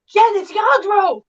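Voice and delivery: high, slow plea to Jen; pleading correction. Retold or imitated. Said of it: "trying to will it to her through the screen".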